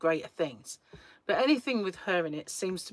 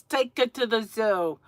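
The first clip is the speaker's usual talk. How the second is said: put-on Rocky movie voice, louder; Rocky film quote; 3 semitones higher, 5 dB louder than usual. Viewer who can't help quoting Rocky, in a Rocky voice. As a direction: quote the film in its own accent. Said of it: "one phrase that pops into my mind from Rocky".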